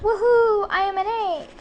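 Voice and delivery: loud, high whooping cheer; excited shout. Acted. Lionel showing off upside down, loud whooping shout. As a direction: whoop it out from the monkey bars. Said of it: "Lionel shouts".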